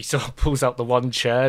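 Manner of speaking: ordinary talk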